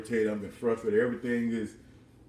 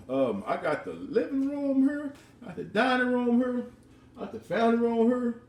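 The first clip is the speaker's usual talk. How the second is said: giddy excitement; 13 semitones higher than usual. Retold or imitated. Touring the dream house.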